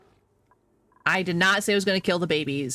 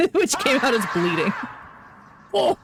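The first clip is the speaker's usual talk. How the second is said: real laugh breaking into the words, higher; 6 semitones higher than usual. Real, not acted. Streamer admits the slip to chat, laughing. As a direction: laugh through your own slip.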